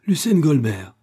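high, loud cry of her name, moved; surprised cry. A narrator's reading. Olivier seeing Lucienne again, crying out her name. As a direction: call out her name in sudden emotion. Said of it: "s'écria Olivier".

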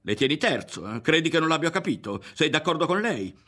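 high, accusing tirade; narrated mother's angry scolding; angry cry. A narrator's reading. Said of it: "gridò allora".

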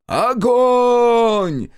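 high, long-held battle cry; shouted command. A narrator's reading. Reshetov ordering partisans to fire at the plane, drawn-out shout. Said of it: "Крик Решетова".

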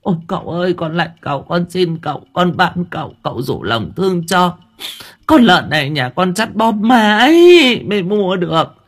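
high, wailing peasant woman begging; wailing plea. A narrator's reading. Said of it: "mà gào lên".

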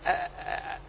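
whispered long aa sound; long vowel demo. Shown as a demonstration. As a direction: breathe out a drawn aa. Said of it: "حرف مد".

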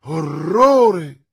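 grido d'orrore, molto forte; esclamazione inorridita. A narrator's reading. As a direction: lancia l'orrore a piena voce. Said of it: "esclamò D'Artagnan". (D'Artagnan inorridito dal racconto di Athos, grida)

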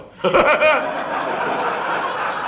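high breathy laugh bursting out; sudden amused laugh; burst of laughter. Real, not acted. Chef laughs at Paul's scratching.

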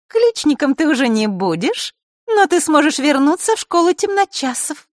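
put-on high, sneering girl's voice; mocking girl. A narrator's reading. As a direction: rub it in. Маришка mocks.

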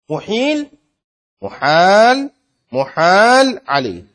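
محيل high, محال loud, محال عليه slow and loud; listing terms with emphasis. Shown as a demonstration. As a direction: call out each term to drill it. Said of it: "فهمتم ولا لا؟". (تعداد المصطلحات بتشديد)

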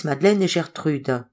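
ordinary delivery

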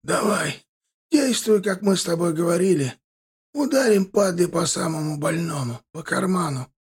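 high, strained, failing voice; wounded boss straining to speak; weak, strained voice. A narrator's reading. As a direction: give orders while struggling for breath. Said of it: "проговорил едва слышным голосом Кулым".

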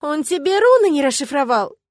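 excited, as if solving it; exclaimed guess. A narrator's reading. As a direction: blurt out the sudden guess. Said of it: "осенённая догадкой воскликнула Матильда".

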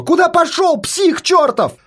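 very high, frantic scream; shrieked angry abuse, voice way up; yelling. Acted. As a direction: yell furiously after the captor leaving. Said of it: "завопил Рома".

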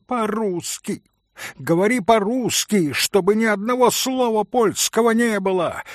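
high, angry shout; shouting at him. Acted. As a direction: lose patience and yell at him. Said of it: "закричала она на него". (Грушенька кричит на пана, высоко и гневно)

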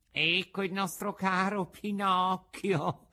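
high, effusive fox voice; fox crying out. A narrator's reading. Fox hails puppet on the road, effusive cry. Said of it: "gridò la volpe abbracciandolo".

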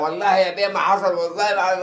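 pleading denial. Retold or imitated. المتهم يحلف ببراءته متوسلًا.

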